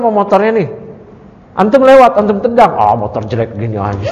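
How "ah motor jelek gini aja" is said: low, quick scoffing at a beat-up bike, laughing through it; mock put-down of a friend's bike; dismissive mock sneer. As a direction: brush it off like junk, with a laugh. Mocking someone's beloved motorbike.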